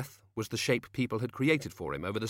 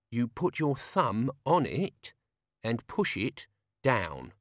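slow patient explaining; slower than usual. Acted. Albert explaining slowly.

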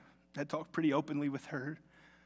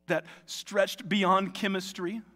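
preaching; 6 semitones higher, 6 dB louder than usual. Real, not acted.

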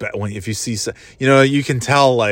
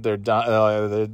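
ordinary talk

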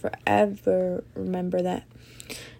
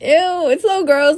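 disgusted; 9 semitones higher, 9 dB louder, slower than usual. Real, not acted. Disgust at Cassie and Nate.

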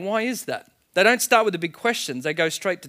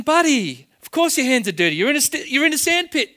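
higher, louder, incredulous "of course"; mock disbelief at the obvious; exasperated; 9 semitones higher, 4 dB louder than usual. Preacher to a crying kid in a sandpit, higher and louder.

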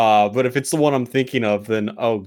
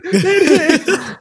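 excited; 5 dB louder than usual. Real, not acted. Odds match, shout of surprise.

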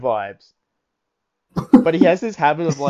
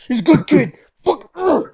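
higher and louder, gushing over the new worker; mock boss who can't contain his love for the kid; overexcited praise; 11 semitones higher, 7 dB louder than usual. Boss gushing about Barry to his coworkers, hammed up.

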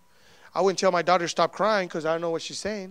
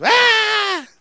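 high, sustained mock wail; imitated baby cry; 13 semitones higher, 4 dB louder than usual. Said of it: "He just cries".

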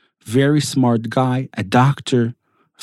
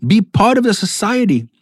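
retold angry shout; 7 semitones higher than usual. Doctor losing it at immigrant parents, high retold shouting. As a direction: imitate an exasperated doctor berating them, voice pitched well up. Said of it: "begins to shout at them and say".

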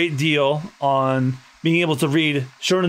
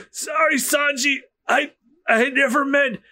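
panicked shout; 9 semitones higher than usual. Acted. Chefs shouting at Sanji in panic. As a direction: yell in alarm.

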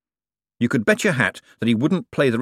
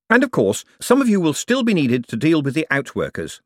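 raised, projected speech to workers; smooth boss pitch; 8 semitones higher, 4 dB louder than usual. Acted. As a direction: sell it to the men with assurance. Moist addresses the men.

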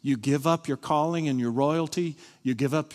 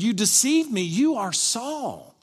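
high, loud accusing cry; mock scream; 9 semitones higher, 3 dB louder, slower than usual. A narrator's reading. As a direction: cry out the accusation, drawing out the last words. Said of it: "she screamed".

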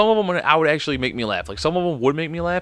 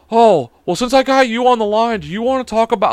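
higher, louder salesy caller voice; pushy telemarketer; 8 semitones higher, 6 dB louder than usual. Retold or imitated. Host imitating a scam caller keeping him on the line, high and loud. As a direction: push the pitch like a cheerful cold caller. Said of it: "I'm like".

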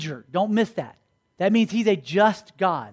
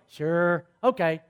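lower, deflated agreement; mock disappointed acceptance; let-down daughter; 5 semitones lower, slower than usual. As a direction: agree reluctantly, voice dropping.

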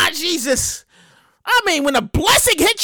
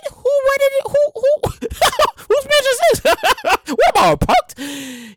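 much higher, baffled questions; incredulous guest; 9 semitones higher than usual. Retold or imitated. Wedding guest asking whose mansion this is, incredulous. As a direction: ask it like you can't believe it.

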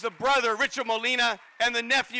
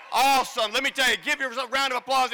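normal talking voice

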